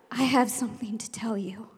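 unvoiced secret whisper; conspiratorial whisper. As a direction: hush them with a secret. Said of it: "all of a sudden I said".

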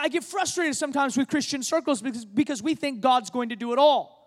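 normal talking voice

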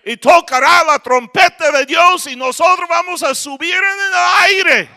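shouted high preaching, stretching out aire; full-force preacher's shout. Acted. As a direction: thunder it out to the congregation. Preaching climax about rapture.

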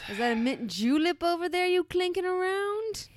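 high, loud southern belle tease; mock southern lady voice. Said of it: "southern belle teasing voice".

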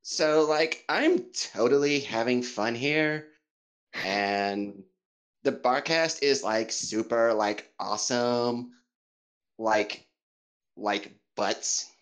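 lightly put-on valley girl American; casual California accent, light. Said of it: "will you please do an American accent for us?".

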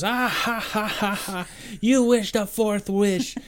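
high, loud mock-triumphant cackle and crow; gloating trickster king. Leprechaun king tricking Darby out of wishes, gloating. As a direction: crow over a trick with a theatrical laugh.

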